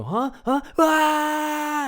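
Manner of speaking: rising confused huhs then a high shriek; panic, then scream as it grabs you; victim's startled scream. Retold or imitated. Teen victim attacked in the woods, confused then shrieking. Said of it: "they go".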